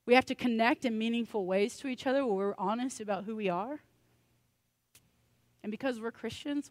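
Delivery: ordinary talk, nothing marked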